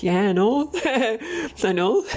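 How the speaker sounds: retold laughing kid. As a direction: giggle it out. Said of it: "And we're like".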